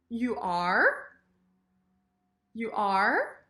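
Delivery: "are" rising sharply at the end; questioning "You are?". Shown as a demonstration. Teacher models questions for learners, rising "are". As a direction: ask with a full "are" sweeping up. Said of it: "sentences and questions".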